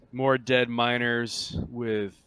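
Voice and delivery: ordinary delivery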